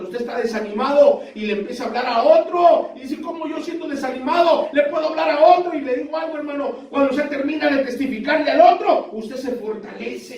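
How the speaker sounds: high, urgent pulpit encouragement; fervent preaching. Real, not acted. Preacher encourages the discouraged to testify to others, fervent preaching.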